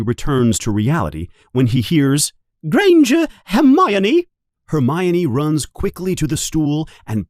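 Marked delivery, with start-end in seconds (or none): 2.7-4.3 much higher and louder name call; teacher summoning a pupil. Acted. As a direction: ring the name out across the hall. Teacher calling names.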